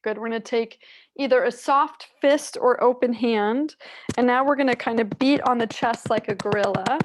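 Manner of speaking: ordinary talk, nothing marked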